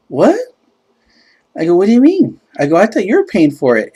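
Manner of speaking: high, loud feigned bafflement; hammed-up surprise; mock-innocent protest. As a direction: act stunned, pitch and volume way up. Acts out his mock-innocent protest.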